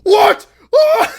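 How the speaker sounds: very high, loud comic shriek; panicked disbelief. Acted. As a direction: scream it in mock shock. Fish finding itself in outer space.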